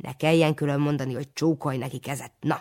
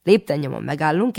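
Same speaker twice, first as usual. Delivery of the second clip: ordinary delivery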